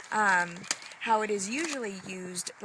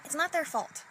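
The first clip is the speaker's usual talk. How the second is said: usual speaking voice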